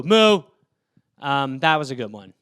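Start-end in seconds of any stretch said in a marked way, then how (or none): 0.0-0.4 loud cow moo; interrupting cow. Acted. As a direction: butt in with a sudden loud moo. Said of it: "Interrupting cow".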